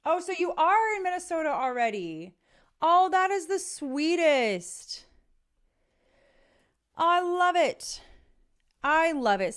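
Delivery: thrilled, high-pitched fan gush; gushing delight. Real, not acted. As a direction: squeal with delight at the news. Gushing reply to live viewer.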